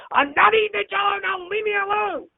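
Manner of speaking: sit up and snap back with new life; energetic refusal. Retold or imitated. Dying father suddenly sits up to refuse, high and irritable. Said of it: "with as much energy as I've seen him have in weeks".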